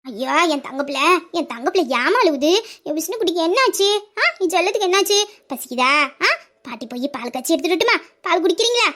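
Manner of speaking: low, cooing comfort; doting low baby-talk; soothing grandmother. Acted. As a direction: coax a crying little one tenderly. Grandmother soothing a crying child.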